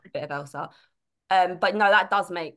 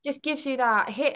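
normal talking voice

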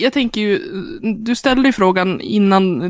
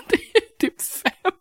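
high, slow, soft and giggling; laughing reveal; 10 semitones higher, 6 dB quieter, much slower than usual. Retold or imitated. Friend telling Emma about five books, laughing. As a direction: break the news through a laugh. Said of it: "Så googlade jag bara".

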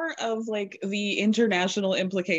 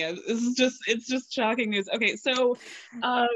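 normal talking voice

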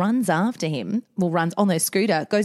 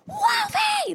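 very high drawn-out "Wolfie!"; exasperated call. Retold or imitated. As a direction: shriek the name in relief and fury. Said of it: "I'm like".

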